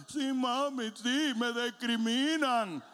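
slow, put-on childish whine with a laugh; sly little boy. Cunning boy agrees with his mother, slow childish whine. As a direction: play the crafty kid agreeing with mom. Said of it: "el niñito hace".

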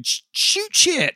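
mock scream.

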